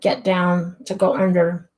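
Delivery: ordinary talk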